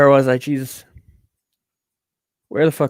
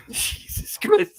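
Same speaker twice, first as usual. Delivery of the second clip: laughing; 5 dB quieter, much slower than usual. Real, not acted. Laughing aside at the text.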